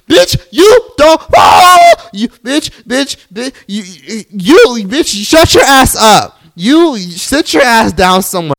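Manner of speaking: loud, high, slow furious yelling; real outraged screaming at her; furious rant. Real, not acted. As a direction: tear into her, shouting. Ranting at Catherine's quote.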